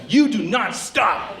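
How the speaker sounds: every word of "you do not stop" slow and punched; emphatic command. Acted. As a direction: drag out "you do not stop" high and forceful. Emphatic command after a build-up.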